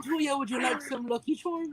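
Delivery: a light, hammed-up mock Irish voice; sing-song mock Irish, offering cereal; mock Irish accent. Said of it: "we gotta say something in Irish".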